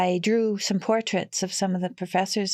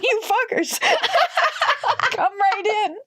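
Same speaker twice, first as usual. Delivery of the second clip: high, loud, laughing outrage; high mock indignation through laughter; laughing disbelief; 4 dB louder than usual. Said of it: "I'm like".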